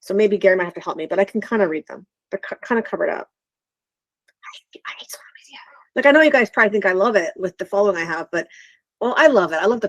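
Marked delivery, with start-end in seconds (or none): 4.4-5.6 hushed, fully whispered aside; whispered confession. Real, not acted. Host confiding in the live audience, whispered. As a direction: whisper it like a guilty admission.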